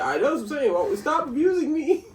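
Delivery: high, loud playful yelp; mock protest. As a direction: cry out in mock outrage, voice shooting up. Playful protest at co-host's teasing.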